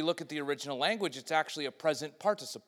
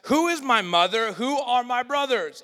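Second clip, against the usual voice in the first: loud proclaiming; 6 semitones higher, 9 dB louder than usual. Retold or imitated. Jesus' question, loud.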